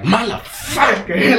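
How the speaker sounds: high, drawn-out mock curse; mock cursing. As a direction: imitate a fed-up dad swearing, half laughing. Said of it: "he starts cursing".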